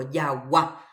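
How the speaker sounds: yagua with the last A lifted; stress on final A. Shown as a demonstration. Teacher illustrating prosodic accent, stress on final A. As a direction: lean on the second A of yagua. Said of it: "estamos utilizando el acento prosódico".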